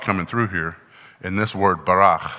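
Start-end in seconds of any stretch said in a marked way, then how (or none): 1.9-2.3 barach said the way Hebrew speakers would say it; a careful attempt at the Hebrew barach; Hebrew pronunciation. Shown as a demonstration. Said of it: "my attempt at saying it like the Hebrew people do".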